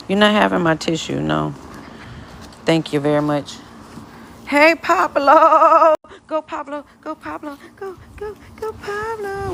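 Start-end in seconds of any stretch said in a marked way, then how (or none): 4.5-9.5 cheering on dog. Real, not acted. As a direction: egg the dog on in a tiny high voice.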